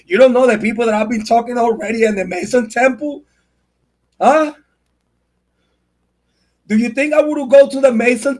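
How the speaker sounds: real indignant outburst, pitched high; angry challenge. Real, not acted. Parent insisting they'd do anything for their kids, angry. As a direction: fire the questions out high and angry.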